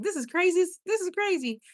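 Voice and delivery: high voice of someone marvelling at it; retold disbelief. Recalling own first live stream, in high-pitched disbelief.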